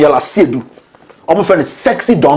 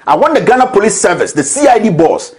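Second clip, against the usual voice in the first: ordinary talk, nothing marked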